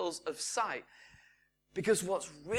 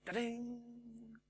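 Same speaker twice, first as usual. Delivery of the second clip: quiz-show ding; 5 semitones higher, 12 dB quieter than usual. Pretend. Correct-answer sound effect.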